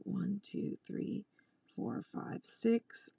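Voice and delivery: soft unvoiced count under the breath; whispered stitch count. Real, not acted. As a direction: count to yourself without voice.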